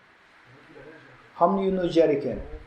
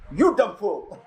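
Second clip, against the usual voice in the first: higher-pitched comic comeback; retorting insult; 3 semitones higher, slower than usual. Retold or imitated. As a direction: throw the insult back in mock outrage. Retort retold for a laugh.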